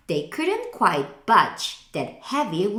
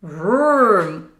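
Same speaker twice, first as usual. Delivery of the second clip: louder revving vroom; engine vroom; 7 dB louder than usual. A narrator's reading. The dump truck speeding round a curve. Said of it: "went the dump".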